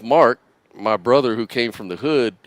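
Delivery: ordinary talk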